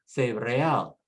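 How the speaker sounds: cereal as seh-reh-AL, stress on AL; Spanish last-syllable stress. Shown as a demonstration. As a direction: say cereal with Spanish vowels, ending on AL. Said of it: "stress on the last syllable".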